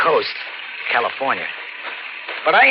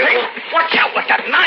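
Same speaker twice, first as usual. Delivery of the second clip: alarmed shout; 13 semitones higher than usual. Acted. Drifter warning the stranger with the knife, alarmed shout. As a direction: yell in panic at the knife.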